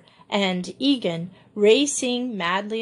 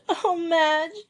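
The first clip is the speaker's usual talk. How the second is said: exhausted sob; 8 semitones higher than usual. A narrator's reading. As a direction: plead through the tears, drained. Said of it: "sobbed Joey exhaustedly".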